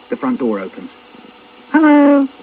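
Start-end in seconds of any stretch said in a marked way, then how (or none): 1.7-2.3 high, loud sing-out hello; calling out. Acted. Mum calls out at the front door, high call. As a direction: call through the house on arriving. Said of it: "calls out my mum".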